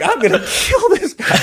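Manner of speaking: high, squeaky comic outrage; mock fury. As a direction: rage at him half-laughing, voice shooting up. Retold roadside wrestling, played for laughs.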